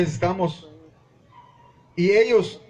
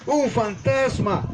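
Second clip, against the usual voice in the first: slow, higher startled gasp of a word; frightened cry; 8 semitones higher, much slower than usual. A narrator's reading. Disciples seeing Jesus on the sea, scared slow cry. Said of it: "se turbaron diciendo".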